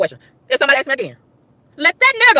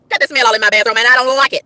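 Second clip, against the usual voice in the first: ordinary talk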